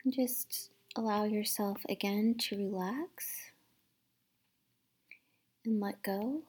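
gentle guided meditation voice, partly whispered; calm, hushed meditation guide. Acted.

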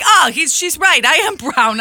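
high, loud amused agreement; imitating the boyfriend. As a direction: voice him playing along. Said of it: "he was like".

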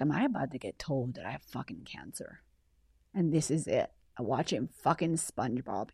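low, quiet inner voice; hushed dread. Retold or imitated. Patient waiting for cancer results by the pool, hushed dread. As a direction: drop to a low, soft murmur of dread.

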